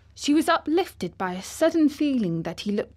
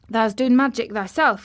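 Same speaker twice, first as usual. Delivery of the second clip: Yorkshire dialect; 3 semitones higher, 7 dB louder than usual. Acted. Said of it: "cheerful grin".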